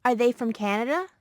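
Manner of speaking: "Are they from Canada?" rising up through "Canada"; rising intonation. Shown as a demonstration. Model speaker demonstrating a yes-no question, rising tune. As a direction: let "Canada" climb at the end like a real question. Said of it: "Use rising intonation in yes-no questions".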